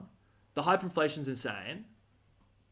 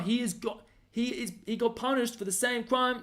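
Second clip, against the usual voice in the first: ordinary delivery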